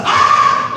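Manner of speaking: very loud unvoiced ah of wonder; heaven's crowd shouting in amazement; joyful shout. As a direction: let out an ecstatic cry. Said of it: "heaven is just like".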